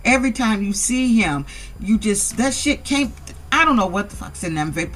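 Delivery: ordinary delivery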